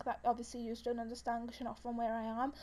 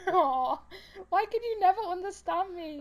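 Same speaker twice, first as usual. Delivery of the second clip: higher, plaintive complaint; mock exasperation; 5 semitones higher, 4 dB louder than usual. Real, not acted. Speaker to friends who misheard her, exasperated. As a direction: whine it at friends who never get you.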